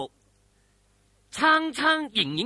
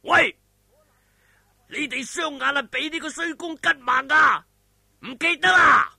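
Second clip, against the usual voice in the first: a much higher, much louder roaring command; great shout; 8 semitones higher, 9 dB louder than usual. A narrator's reading. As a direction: bellow it across the cave at his men. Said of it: "大喝一聲話".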